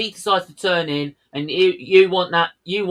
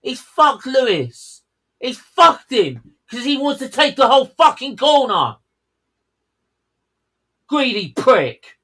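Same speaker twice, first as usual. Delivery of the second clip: real exasperated anger, higher pitched; angry outburst; 7 semitones higher, 3 dB louder than usual. Real, not acted. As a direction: rant indignantly at the driver. Angry outburst at a race crash.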